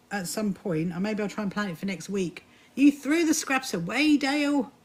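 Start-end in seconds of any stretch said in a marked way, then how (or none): 2.8-4.7 higher, louder, slowed dismay; mock horror. Real, not acted. Host reacting to viewer Dale binning scraps, mock horror. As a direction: play up the shock at the waste.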